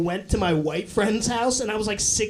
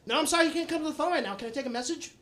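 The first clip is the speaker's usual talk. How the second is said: mom's phone voice; 2 semitones higher, 6 dB quieter, much faster than usual. Acted. Mom answers the phone mid-yell, imitated polite phone voice. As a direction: imitate mom sweetly taking a call. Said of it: "mid-yell answer the phone".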